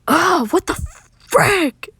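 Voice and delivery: high, loud shriek of alarm; panicked yell. A narrator's reading. Narrator voices a character waking in alarm, panicked yell. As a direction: yelp it out in sudden panic. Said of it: "begins to panic a bit".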